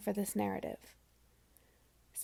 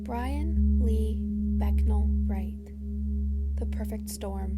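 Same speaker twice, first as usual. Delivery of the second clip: slow, louder, weighty reading of the name; slow title announcement; 5 dB louder, slower than usual. A narrator's reading. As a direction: lay out each name slowly. Memorial title read aloud.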